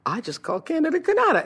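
high-pitched, startled realization; catching own mistake. Retold or imitated. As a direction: re-enact noticing your blunder on air. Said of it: "and I caught myself".

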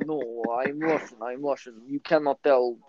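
slow, put-on Russian accent; mock Russian accent. Pupil insisting to substitute teacher, fake Russian accent. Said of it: "trying to do a Russian accent".

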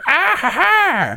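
high, slow villain cackle; monster laugh. Retold or imitated. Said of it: "the weird monster voices".

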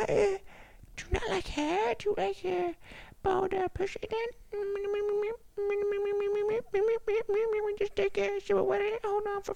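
high mock voice jeering at a man who shaves; high-pitched Tim Dillon impression. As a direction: squeal it up high, teasing. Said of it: "doing a lot of, uh, Tim Dillon with a high pitch shit".

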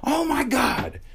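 high-pitched, exasperated parental outburst; mock overreaction. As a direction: play the too-tough parent, blowing it out of proportion. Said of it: "being the wrong thing would have been like".

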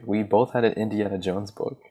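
ordinary talk

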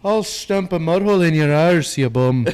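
mock Irish tough guy; Irish accent. Friend tops the joke with a loud mock Irish threat. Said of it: "it changes up a little bit".

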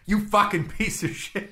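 high, sudden mock fury; mock angry outburst. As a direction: snap it like a scared brother turned angry. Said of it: "he was just like".

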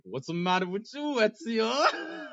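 teasing call. Retold or imitated. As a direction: call out in mock concern. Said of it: "So I'm like".

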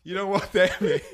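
loud, laughing through it; warning while laughing loudly. Real, not acted. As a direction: crack up mid-warning.

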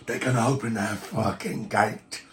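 grim warning in a put-on voice; menacing caged captive; draconic prisoner. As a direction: warn the intruders ominously.

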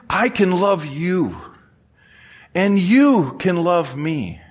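slow, deliberate declaration of love; measured, heartfelt preacher with long pauses; imitated earnest pastor. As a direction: spell it out slowly to a drunk. Voices pastor addressing the drunk man.

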